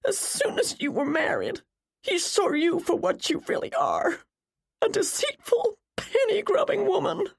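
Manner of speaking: high, tearful denunciation; weeping voice, pitched far up; sobbing accusation. A narrator's reading. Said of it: "Jennifer sobbed".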